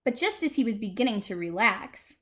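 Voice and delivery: ordinary delivery, nothing marked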